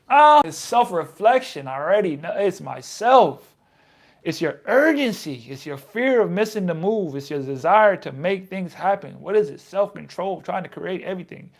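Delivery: pumped motivational read; fired-up reading. Real, not acted. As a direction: hype yourself up.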